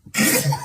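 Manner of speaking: a quick breathy laugh. Real, not acted. Laughing during the accent comparison.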